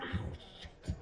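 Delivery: whispered pained ow, shit. Acted. Inmate bumping his head on the glass while dancing, whispered pain. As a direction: hiss it as you bump your head.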